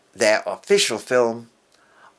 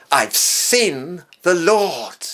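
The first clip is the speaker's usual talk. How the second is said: higher, louder, slow and exultant; exultant announcement; 7 semitones higher, 7 dB louder, much slower than usual. Retold or imitated. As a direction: proclaim it with awe. Mary tells the disciples.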